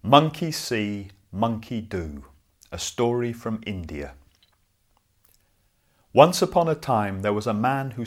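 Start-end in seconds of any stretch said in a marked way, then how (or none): none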